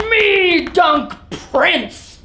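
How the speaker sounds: put-on slow whooping cave-girl cry, laughing. A narrator's reading. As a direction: whoop it out slowly, laughing with glee. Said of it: "pounding her chest and whooping".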